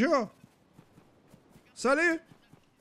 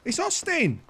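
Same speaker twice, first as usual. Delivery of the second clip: insistent yell; 12 semitones higher, slower than usual. Real, not acted. Insisting to stranger.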